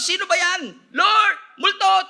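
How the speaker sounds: high, loud panicked calling out; panicked shout. Acted. Preacher acts disciples in the storm, panicked shout. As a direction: cry out in alarm at a ghost. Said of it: "sumigaw sila".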